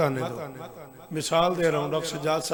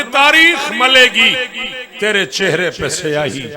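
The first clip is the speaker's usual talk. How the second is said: declaimed denunciation; 11 dB louder than usual. Acted. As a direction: proclaim the curse loudly, stepping down in pitch.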